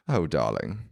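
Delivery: low, soothing British matron; middle-aged British lady reassuring someone; British woman. Retold or imitated. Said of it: "a British woman, like, a middle-aged British woman".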